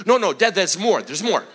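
loud, insistent interjection; urgent kid protest. Retold or imitated. Son interrupts with more excuses.

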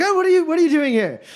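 high, fast, loud demand; fast high alarmed shout at strangers; alarmed challenge. Acted. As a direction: challenge the intruders sharply. Armed laborer confronts party.

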